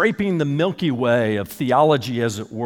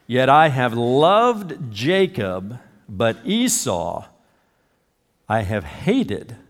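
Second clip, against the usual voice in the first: slow, weighty, falling on hated; slow grave recitation; solemn scripture; much slower than usual. Acted. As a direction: lay each word down slowly and gravely.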